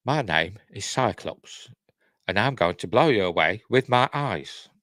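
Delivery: measured mock-menacing superhero introduction; Michael Caine. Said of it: "is that Michael Caine?".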